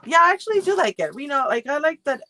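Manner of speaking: high, loud and quick burst; excited. Real, not acted. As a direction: blurt it out with sudden excitement. Sudden burst after laughing.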